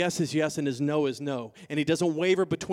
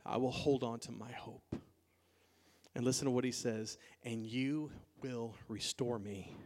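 hushed prayer; 6 semitones lower, 11 dB quieter than usual. Acted. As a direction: pray it low and intimately, almost to yourself. Voices David's prayer, hushed.

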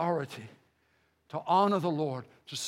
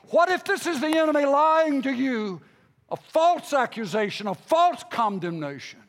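raised, urgent pulpit questions; impassioned preacher challenging the flock; forceful preaching; 6 semitones higher, 4 dB louder than usual. Acted. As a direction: press each question home like a preacher.

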